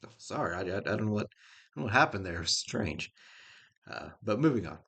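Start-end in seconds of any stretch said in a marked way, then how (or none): none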